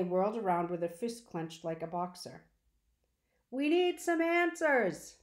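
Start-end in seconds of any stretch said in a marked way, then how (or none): 3.5-5.2 high, loud and slow shout over a roaring vacuum; girl shouting her demand over a vacuum cleaner. A narrator's reading. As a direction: call out over the machine noise, drawn out. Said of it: "Sistine shouted over the roar".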